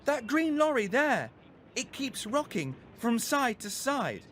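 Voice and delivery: put-on boy showing his mum the rocking lorry; urgent boy. A narrator's reading. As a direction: point it out anxiously. Abdu points out lorry.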